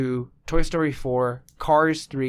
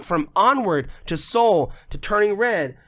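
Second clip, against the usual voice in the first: normal talking voice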